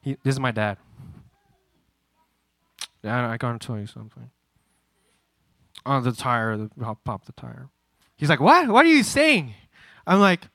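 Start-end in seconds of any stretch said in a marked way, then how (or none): 3.0-7.7 low, soft stammered admission; acted-out nervous, halting confession; timid confession. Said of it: "this is literally how it was". 8.4-9.5 loud, high disbelief; acted-out startled father; incredulous dad. Acts dad's startled reaction.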